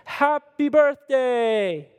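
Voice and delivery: a packed diner shouting together; crowd yelling. Retold or imitated. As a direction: yell it like a whole room surprising her. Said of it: "everyone screams at the top of their lungs".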